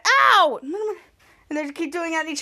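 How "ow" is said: loud high ow; cartoon zap pain; mock ow. Zapped during the fight, a loud ow. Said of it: "does it to Ray and said".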